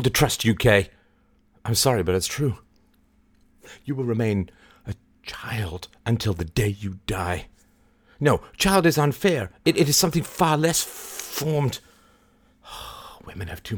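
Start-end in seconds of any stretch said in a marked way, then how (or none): none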